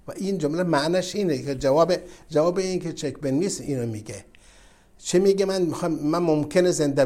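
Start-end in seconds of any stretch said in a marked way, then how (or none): none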